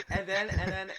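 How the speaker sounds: giggling through the words; can barely get it out for laughing. Real, not acted. Laughing while retelling.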